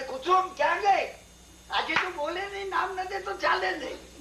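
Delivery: lightly voiced priest calling his wife; a hint of an old priest calling.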